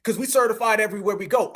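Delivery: ordinary talk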